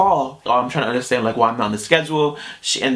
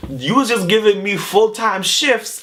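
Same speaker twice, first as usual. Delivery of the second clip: indignant protest; 8 semitones higher, slower than usual. Retold or imitated. Retelling protest to manager.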